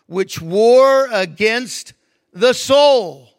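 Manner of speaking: emphatic preacher reading from the Bible; scripture reading. Acted. Preacher reading an epistle to the congregation, declaimed with weight. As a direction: proclaim the verses, leaning hard on key words.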